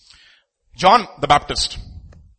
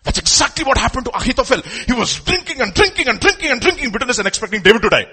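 climactic preaching; 9 semitones higher, 5 dB louder than usual. Real, not acted.

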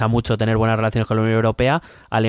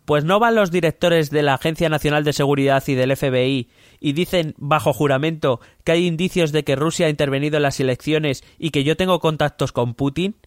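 higher-pitched Trump diary voice; 5 semitones higher than usual. Acted. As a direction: read it as a pompous Trump. (voz de Trump, más aguda)

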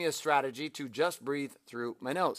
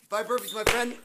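out of breath; 12 semitones higher than usual. Real, not acted. Just did burpees on camera.

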